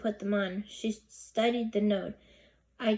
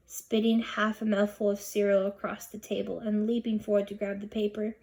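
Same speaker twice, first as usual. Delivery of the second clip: ordinary delivery, nothing marked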